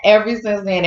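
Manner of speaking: normal talking voice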